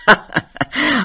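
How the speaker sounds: a short light man's laugh; hearty laugh. Acted. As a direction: laugh it off easily. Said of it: "he said with a laugh".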